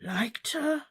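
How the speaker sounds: an unvoiced, choked whisper; tearful whisper. Slughorn, eyes brimming, whispering about Lily. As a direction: whisper it through tears. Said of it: "his eyes brimming with tears".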